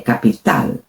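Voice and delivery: "capital" with a crisp T on stressed final -tal; "capital" low, Spanish ending with hard T; strong T. Shown as a demonstration. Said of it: "it's a strong T".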